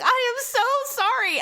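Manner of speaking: high, slow, imitated contrition; emphatic apology. Husband apologising to the wife he grabbed, emphatic apology.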